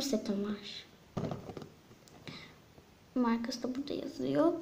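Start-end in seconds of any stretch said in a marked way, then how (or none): 3.1-4.6 whispering. Real, not acted. Whispered aside while showing box.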